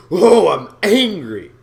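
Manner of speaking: loud, slow, flat I'm angry; deadpan anger. Pretend. Walking in on his girlfriend, calmly announced anger. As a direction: announce anger without feeling it. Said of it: "you can be angry".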